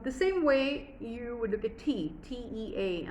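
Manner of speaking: ordinary delivery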